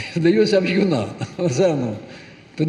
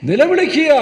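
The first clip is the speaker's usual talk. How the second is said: fervent emphasis; 3 semitones higher, 3 dB louder, slower than usual. Real, not acted. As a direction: drive the verse home, building up. Preacher repeats verse forcefully. (സാവധാനം തുടങ്ങി ഉച്ചത്തിൽ ഉയരുന്ന പ്രസംഗ ഊന്നൽ)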